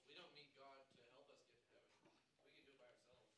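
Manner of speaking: ordinary delivery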